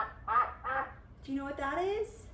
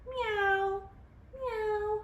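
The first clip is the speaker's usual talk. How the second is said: high cat meow; kitty meow; 8 semitones higher than usual. Shown as a demonstration. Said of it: "Can you make the sound of a cat?".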